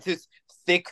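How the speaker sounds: thick mock Austrian accent, halting and defensive; Arnold. Podcaster as Arnold denying his accent, mock Austrian accent. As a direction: play innocent in a hammed-up Austrian accent. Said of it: "Austrian accent".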